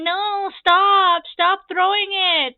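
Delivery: high, loud, drawn-out whine; self-mocking childish whine; little whiner. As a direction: whine in protest like a pestered kid. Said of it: "being a little whiner".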